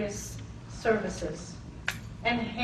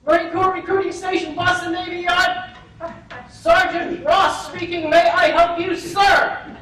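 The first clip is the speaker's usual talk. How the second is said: louder, higher, fast crisp phone greeting; louder, fast military phone answer; brisk sergeant; 6 semitones higher, 11 dB louder than usual. Retold or imitated. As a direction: snap it off like a drill-sharp recruiter.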